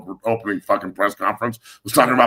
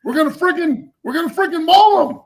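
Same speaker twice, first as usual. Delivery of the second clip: high, loud, building hammed-up pep rally; mock hype coach; 6 dB louder than usual. Mocking the coach's clean-mouthed hype speech, high hammed-up shouting building to a shout. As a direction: shriek it higher and higher like an overhyped coach.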